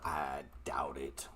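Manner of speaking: breathy whispered aside, low. Real, not acted. Host to viewers, whispered doubt. Answering own question in aside.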